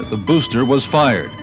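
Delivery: usual speaking voice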